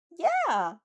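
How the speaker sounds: high, loud yell of support; shout. A narrator's reading. Mina cheering Athena on, loud excited yell. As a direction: shout it out for your friend. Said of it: "Mina shouts".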